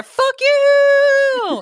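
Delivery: high, drawn-out parting shot; defiant yell. Acted. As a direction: hurl it out in a high, stretched whoop. Defiant last words acted out.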